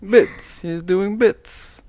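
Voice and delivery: high, slow teasing sing-song. Pretend. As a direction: drag it out to annoy her. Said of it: "You did this last night, too".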